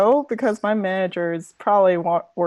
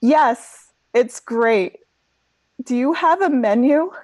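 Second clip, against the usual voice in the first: higher, lightly voiced woman customer; giggly customer; 6 semitones higher, 3 dB louder, slower than usual. Woman customer asks server for a menu, lightly giggly. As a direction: play a slightly giggly diner. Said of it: "just, like, giggles a little bit".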